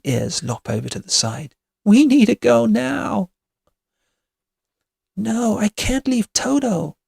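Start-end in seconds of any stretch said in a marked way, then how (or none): 1.8-3.3 high, loud desperate insistence; panicked urging. A narrator's reading. Kitty, pale as a ghost, wants to flee, panicked. 5.2-7.0 high, pleading protest; distressed refusal. A narrator's reading. Dorothy won't abandon her dog, distressed.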